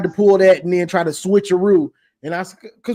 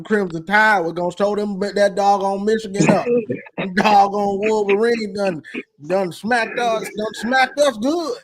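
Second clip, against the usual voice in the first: lightly hammed-up Alabama fan accent, slowing down at the end; milk the southern accent on done smacked us good; Alabama accent again. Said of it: "hey, that was, that was pretty good".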